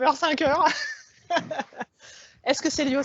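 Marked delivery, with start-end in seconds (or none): 1.3-1.8 light laugh. Real, not acted. Laughs at her own long hours.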